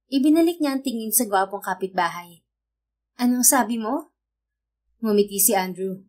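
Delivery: usual speaking voice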